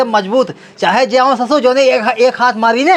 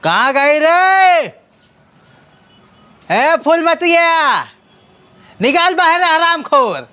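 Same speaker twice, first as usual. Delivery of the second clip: put-on town drunk; 4 semitones higher, slower than usual. Drunk husband calling wife out.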